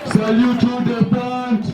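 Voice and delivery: drawn-out parade ground commands; drill commands. Acted. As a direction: call each order to the whole parade.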